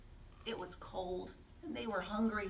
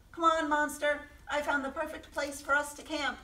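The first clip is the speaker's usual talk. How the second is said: calling out; 6 semitones higher than usual. Acted. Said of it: "Mouse called".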